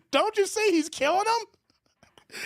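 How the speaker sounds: high, slow horrified cry; mock-panicked wife pointing at the attack; frantic wife. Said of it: "that Chinese wife was like".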